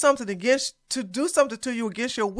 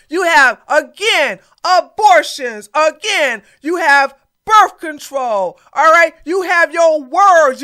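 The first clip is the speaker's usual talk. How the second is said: loud, slow, hammered points; louder slow scolding lecture; emphatic lecturing; 3 semitones higher, 6 dB louder, slower than usual. Real, not acted. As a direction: pound every point home. Lecturing commenter forcefully.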